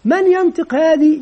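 lifted questioning teacher voice; teacher quizzing pupils. Acted. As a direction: call for a pupil to answer. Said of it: "يمكن مثلًا يقول لهم".

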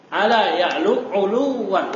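ordinary talk, nothing marked

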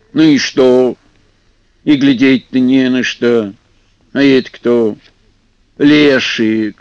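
gruff water spirit. Acted. Vodyanoy grumbles at them.

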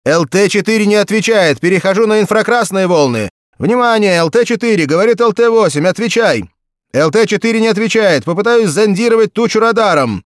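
loud, high tense pilot report; over a pilot headset. A narrator's reading. Pilot reports, searching for crew.

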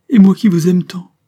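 put-on a child pleading between sobs; tearful plea. A narrator's reading. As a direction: gasp it out through the sobs. Child sobbing after bad news.

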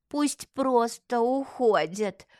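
put-on slow, drawn-out elderly voice; old swindler's slow dismissal; dismissive old woman. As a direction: wave them away in an old woman's drawl. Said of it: "отмахнулась старая мошенница".

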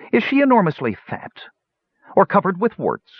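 lightly voiced sneering hunchback; a hint of a cruel interrogator; sneering Pitrick. Pitrick questions the dwarf.

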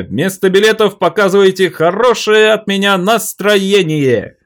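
very high, loud fairground shout; loud carnival barker. Acted. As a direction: hawk the show to the crowd. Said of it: "Ору так и вижу".

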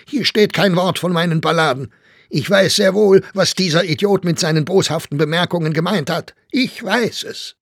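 high, loud, agitated tirade; shouting. A narrator's reading. Staufner rants about the critic's malicious remarks, shouting. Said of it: "schrie Staufner".

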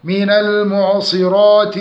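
من المعصرات with a full, heavy ra; chanted من المعصرات, long heavy vowel after r. Shown as a demonstration. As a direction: thicken the ra in المعصرات. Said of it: "تفخيم الراء".

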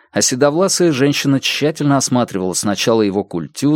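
plain reading voice

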